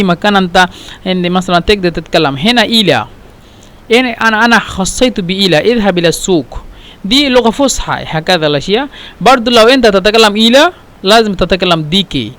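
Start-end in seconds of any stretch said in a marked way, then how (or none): none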